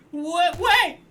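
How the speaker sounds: panicked cry. Acted. Crab watching his shell get taken, high shout. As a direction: yell after the departing shell.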